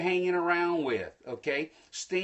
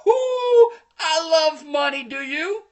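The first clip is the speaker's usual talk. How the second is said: high, loud whoop of delight; gleeful crowing; 11 semitones higher, 9 dB louder than usual. Real, not acted. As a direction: crow over winning the bet. Crowing about winning a bet.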